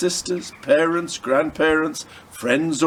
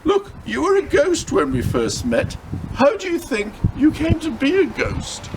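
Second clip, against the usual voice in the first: put-on a boy's voice pitched higher, pressing hard; urgent boy Luca; 11 semitones higher than usual. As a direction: push her to see the truth, urgently.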